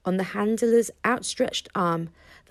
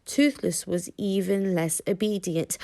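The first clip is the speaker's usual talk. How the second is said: ordinary delivery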